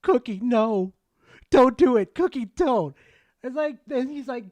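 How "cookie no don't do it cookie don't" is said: louder, urgent "don't do it"; begging Cookie not to go inside; pleading warning. Retold or imitated. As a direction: yell a warning at a friend. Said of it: "I'm like".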